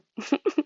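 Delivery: short high-pitched giggle; high little laugh to yourself. Real, not acted. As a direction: giggle at your own embarrassing fall. Laughs over her own fall.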